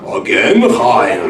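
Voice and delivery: put-on Hungarian accent. Rabbi recalling the candy man in Hungarian accent. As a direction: mimic the old Hungarian candy man, chuckling. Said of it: "saying in his Hungarian accent".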